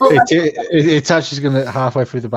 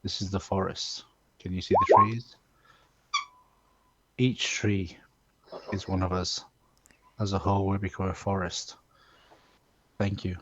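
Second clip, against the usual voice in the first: solemn speech; 3 semitones lower, 4 dB quieter than usual. Acted. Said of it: "say to everyone".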